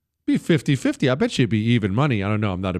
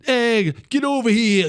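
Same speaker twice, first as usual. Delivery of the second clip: Northeast tough guy accent; 11 semitones higher than usual. Shown as a demonstration. Host demoing a Northeast tough guy accent, brash Northeast tough guy call. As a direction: toss it off like a tough New Jersey dude. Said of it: "Kind of a tough guy accent".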